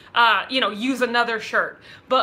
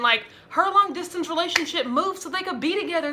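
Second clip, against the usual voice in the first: ordinary talk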